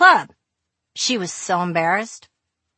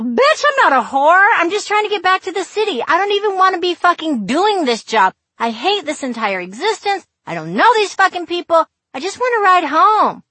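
angry rant; 11 semitones higher, 7 dB louder than usual. Pretend. Imagined angry rant at the girl.

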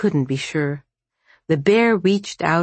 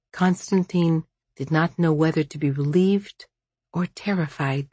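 plain reading voice

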